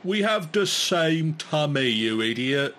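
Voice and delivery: annoyed head voice. Acted. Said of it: "tries to look at the second head".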